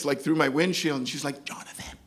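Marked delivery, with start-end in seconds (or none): 1.4-2.0 voiceless whispered name; barely audible, as if read from lips; silently mouthed, imitated. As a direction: whisper it like someone mouthing through glass. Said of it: "I can read her lips".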